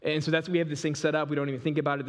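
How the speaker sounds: ordinary talk, nothing marked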